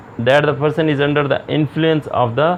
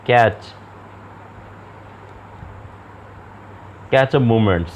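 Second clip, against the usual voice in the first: slow, deliberate dictation pace; slow slide reading; slower than usual. Acted. Lecturer reading slide text to students, slow and measured. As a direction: read each phrase out slowly for the class.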